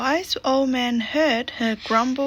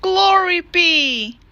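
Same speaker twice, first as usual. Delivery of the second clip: put-on old lady crying out; 7 semitones higher, 3 dB louder than usual. A narrator's reading. Old lady crying out as her house fills up, high and loud. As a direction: exclaim like a fussy little old lady. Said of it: "The little old lady cried".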